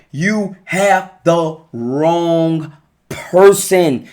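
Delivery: each word drawn out slowly and pressed home; slow, weighty. Real, not acted. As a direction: lay it down slowly so it sinks in. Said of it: "I'm telling you".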